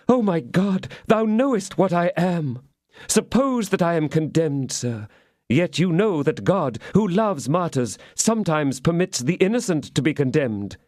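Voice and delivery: high, pleading appeal to God; martyr's plea. Acted. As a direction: play the innocent martyr. Milady playing martyr.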